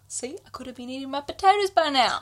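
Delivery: a hint of a complaining little kid; childish Cassian. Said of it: "Cassian is a child".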